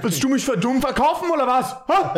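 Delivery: fast, high mock-German outburst; yelling in German. Host showing how to challenge someone, comic German yelling. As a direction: fire it off as an indignant German challenge. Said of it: "I yell in German".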